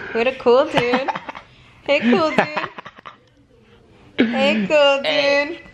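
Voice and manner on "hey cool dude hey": usual speaking voice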